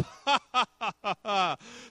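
high, mocking staccato laugh; scornful put-on laugh, drawn out; malicious laugh. God laughing at Satan over this woman, mocking laugh. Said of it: "God looks down and says".

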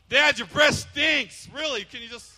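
put-on child bluntly telling dad about his breath. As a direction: say it like a tactless little kid.

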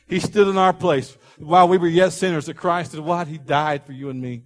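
loud, rising, impassioned preaching; building declarations in a ringing pulpit voice. Real, not acted. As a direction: preach it at full force, punching the key words.

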